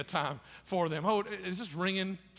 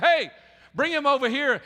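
louder, higher retold call; 7 semitones higher, 7 dB louder than usual. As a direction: call across a crowd, lightly acted. Jesus calls out over crowd.

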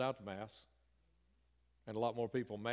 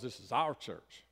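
slow, deliberate weight on "our"; stress on OUR; slower than usual. Shown as a demonstration. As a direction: draw out "our" to make the point. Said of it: "emphasis there on the word our".

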